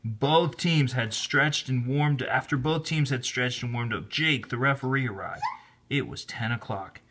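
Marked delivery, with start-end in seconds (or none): none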